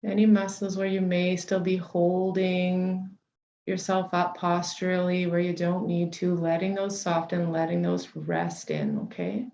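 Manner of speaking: unhurried meditation guide, long pauses between phrases; guided meditation. Real, not acted. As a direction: settle listeners gently into the body, leaving space for each breath.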